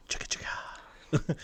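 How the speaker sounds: slow whispered chant; whispered impression. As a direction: breathe it slowly like a chant. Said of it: "Ferris Bueller that was like".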